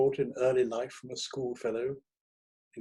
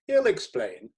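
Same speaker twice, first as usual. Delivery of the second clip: high and loud; hearty editor; 10 semitones higher, 5 dB louder, slower than usual. A narrator's reading. Editor to the critic outside the door, loud and high. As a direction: toss it over the shoulder.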